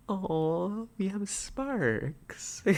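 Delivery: hammed-up dreamy sigh over "sparks"; mock swoon. Streamer swooning at a viewer's electrician pun, very slow. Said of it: "That's why we have sparks".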